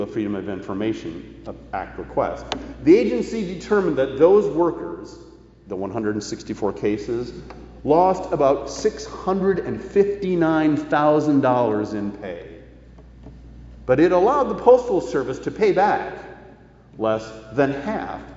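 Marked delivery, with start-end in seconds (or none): none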